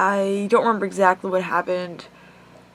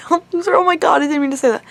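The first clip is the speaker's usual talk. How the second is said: high, loud, rushed backtrack through laughter; flustered laughing; 8 semitones higher, 6 dB louder, faster than usual. Real, not acted. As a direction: laugh in embarrassment while hurrying to take it back. Flustered laughing retraction of an insult.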